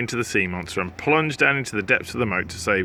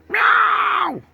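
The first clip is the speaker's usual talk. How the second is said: long, very high cat scream; 5 dB louder than usual. Acted. Cats screaming at the slimy sea. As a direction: shriek like terrified cats.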